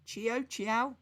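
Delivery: Chio and Chiao said rising and high, guessing; testing Chio as a guess at Italian hello. Shown as a demonstration. Trying to say Italian hello, rising guess. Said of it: "That's not how you say hello".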